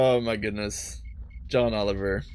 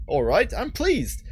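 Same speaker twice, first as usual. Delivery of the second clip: slow, higher-pitched British retake; second go at a British accent, drawn out; put-on British voice, unhurried and lifted; 6 semitones higher, slower than usual. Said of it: "not doing the right British accent".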